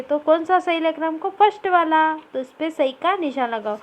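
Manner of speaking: ordinary talk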